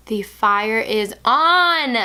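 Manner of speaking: slow, loud, drawn-out hype; triumphant, drawn-out cheer; hyped-up exclamation. Real, not acted. Hyping the fiery eye shadow.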